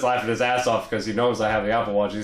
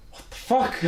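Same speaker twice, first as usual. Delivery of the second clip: high, baffled Portuguese-accented imitation; mock a Portuguese coworker's high-pitched disbelief; Louis. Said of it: "really thick accent".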